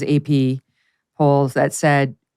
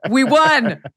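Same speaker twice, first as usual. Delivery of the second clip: mock advisor line. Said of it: "I would have been like".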